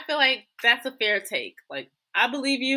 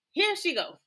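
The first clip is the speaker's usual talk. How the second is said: laughing; 10 semitones higher than usual. Real, not acted. Laughing tease about Nicki.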